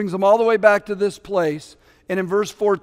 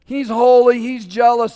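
blunt warning; 4 semitones higher, 8 dB louder than usual. Retold or imitated. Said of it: "He goes".

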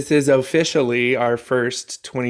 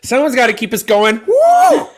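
loud, high whoop of excitement; 8 semitones higher, 7 dB louder than usual. Real, not acted. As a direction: cheer everyone on, full volume. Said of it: "You're our hype girl".

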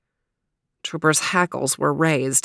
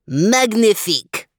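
high, ringing, delighted cry; French exclamation; 5 semitones higher, 4 dB louder than usual. A narrator's reading. Max hears the plan to investigate, exclaiming grandly in French. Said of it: "exclaimed Max".

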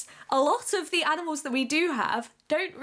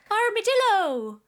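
higher-pitched comic exclamation; funny voice; 6 semitones higher, 3 dB louder than usual. Shown as a demonstration. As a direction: call it out high in a silly voice. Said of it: "says armadillo in a funny voice".

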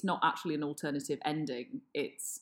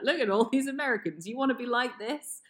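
higher, quick mock appeal to an audience; mock propaganda pitch; 7 semitones higher, 4 dB louder, faster than usual. Censor warning viewers off Americans, mock propaganda pitch. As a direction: put on a scornful sales pitch, higher and quick. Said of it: "plays better to be like".

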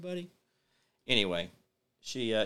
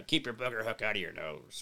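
low, soft pirate voice, lightly done; 5 semitones lower, 9 dB quieter than usual. Pretend. Pitching a pirate shirt slogan to viewers, low pirate voice. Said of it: "a pirate hat and do, like, a".

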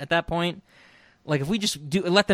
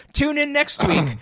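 mock announcer; 11 semitones higher than usual.

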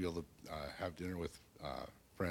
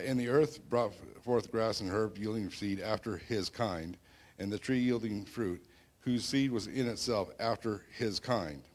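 raised, louder scripture reading; booming biblical narration; 4 semitones higher, 5 dB louder than usual. Acted. Reading Genesis aloud.

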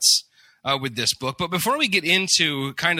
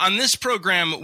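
ordinary talk

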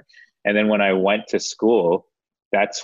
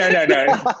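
high laughing protest; 3 dB louder than usual. Real, not acted. Laughing as she denies rebelling at five. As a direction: laugh it off, denying.

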